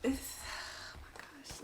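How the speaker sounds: breathless hushed gasp of disbelief; hushed and overwhelmed; awed whisper. Real, not acted. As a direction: whisper it, stunned. Opening her degree mail, overwhelmed.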